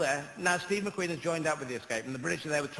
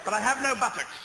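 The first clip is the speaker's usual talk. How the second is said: posh British officer; 7 semitones higher, 4 dB louder than usual. Retold or imitated. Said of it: "People saying".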